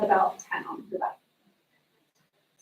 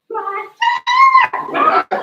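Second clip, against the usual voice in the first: very high, loud scream of the name; mom screaming; 8 dB louder than usual. Retold or imitated. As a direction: scream it at the top of your lungs. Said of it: "yelling at the top of her lungs".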